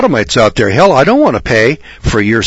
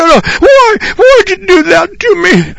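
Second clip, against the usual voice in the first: put-on very high, sobbing little-boy wail; mock-cry like a whipped kid; hammed-up snivelling whine to Dad; whining crying child; 4 dB louder than usual. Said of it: "I cried, and I sniveled, and I weep, wept, and I whined".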